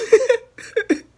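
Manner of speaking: high loud cackle of laughter; sudden loud laugh; burst of laughter. Real, not acted. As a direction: crack up at your own rant. Laughs at own rant.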